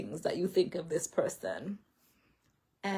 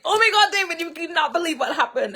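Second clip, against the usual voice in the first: much higher and louder, bursting with news; excited telling; 10 semitones higher, 9 dB louder, faster than usual. Retold or imitated. Telling husband David the news, bursting with excitement. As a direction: blurt it out breathless with excitement. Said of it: "And then I told him".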